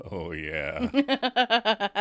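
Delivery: high, sly suggestive drawl; mock seductive oh yeah. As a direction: make it sound dirty. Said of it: "trying to make it dirty".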